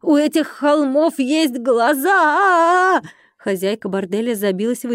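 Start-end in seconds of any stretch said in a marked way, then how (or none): 0.0-3.0 high, loud, drawn-out squeal of horror; hysterical shriek. A narrator's reading. Brothel owner sees the hills have eyes, hysterical shriek. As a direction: shriek it slowly, stretching the last word. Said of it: "ввизгнула дамочка".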